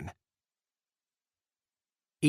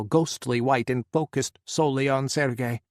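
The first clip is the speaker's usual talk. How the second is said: ordinary delivery, nothing marked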